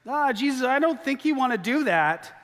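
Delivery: high, awkward, hesitant objection; a nervous high voice, unsure how to object; awkward hesitant Martha. Retold or imitated. Sister hesitantly warning Jesus off opening the tomb, high and awkward. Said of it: "Mary is like".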